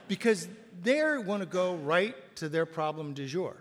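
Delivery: ordinary delivery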